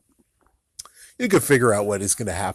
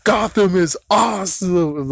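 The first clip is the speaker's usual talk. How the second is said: imitating Michelangelo; 7 semitones higher, 4 dB louder than usual. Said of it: "And he's like".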